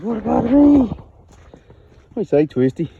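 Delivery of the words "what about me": high, loud mock cow plea; voicing a screaming cow. Farmer voices his hungry cow, high loud mock whine. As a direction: squeal it like a cow left out of feeding. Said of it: "Cow's just screaming. Says".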